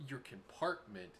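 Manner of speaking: usual speaking voice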